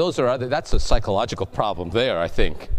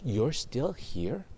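astonished; 5 dB quieter, much slower than usual. Acted. Cashier astonished at waiting lady.

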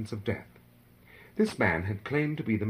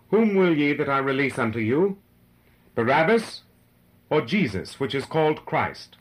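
raised, earnest address to a crowd; great earnestness; 4 semitones higher, 5 dB louder than usual. A narrator's reading. Pilate turns to the crowd to offer a choice, in great earnestness. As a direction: put the question earnestly, voice lifted. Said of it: "he said with great earnestness".

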